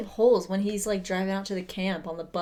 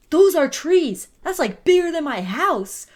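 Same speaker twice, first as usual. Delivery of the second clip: higher and louder astonishment; amazed cousin; 8 semitones higher, 10 dB louder, slower than usual. Retold or imitated. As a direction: marvel like a stunned visitor.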